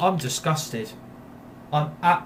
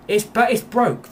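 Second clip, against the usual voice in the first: angry outburst; 7 semitones higher, 5 dB louder than usual. Real, not acted. Gamer fuming at the broken game, raised exasperation. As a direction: pitch jumps up, louder and exasperated.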